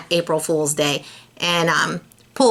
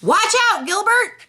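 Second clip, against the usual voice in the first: shout; 13 semitones higher than usual. Acted. Philip shouting a warning to Gilbert. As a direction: yell it across the playground. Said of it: "Then Philip shouted".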